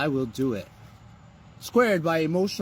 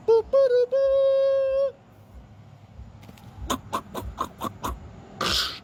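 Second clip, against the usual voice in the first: buzzer beeps; much slower than usual. Pretend. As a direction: mimic a scoreboard buzzer. Imitated buzzer sound.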